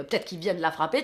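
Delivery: normal talking voice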